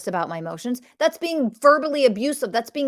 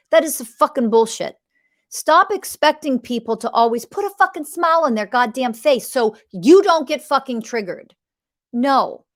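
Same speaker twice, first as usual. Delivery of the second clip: louder, heated scolding; angry rant; 2 semitones higher, 6 dB louder than usual. Real, not acted. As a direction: bite down on every curse. Angry rant at fragile partners.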